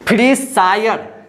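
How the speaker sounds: usual speaking voice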